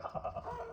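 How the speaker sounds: mock high-pitched ha-ha laugh; imitated laugh. Retelling how he laughed at us, high mock laughter. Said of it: "he laughed and he was like".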